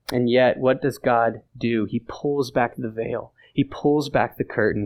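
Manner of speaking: ordinary talk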